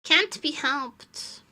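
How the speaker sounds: usual speaking voice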